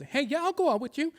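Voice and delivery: high mocking voice of a cruel date; mocking dismissive date. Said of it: "to be told".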